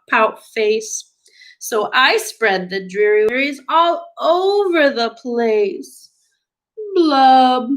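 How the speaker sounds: stretched-out, sing-song storybook lament; gloomy pout-pout fish. Acted. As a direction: drag the opening, then sink through the blubs. Acts the fish's refrain.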